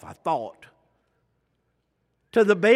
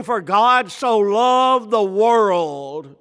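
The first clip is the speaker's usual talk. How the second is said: slow proclaimed scripture; 2 semitones higher, 6 dB louder, much slower than usual. Acted. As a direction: read each word slowly and louder. Preacher reads John 3:16.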